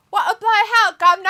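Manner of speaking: loud, high-pitched hammed-up English accent. Host reads John's chat message, in a loud English accent. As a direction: shout it like a cartoon Englishman. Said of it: "John, say it in an English accent".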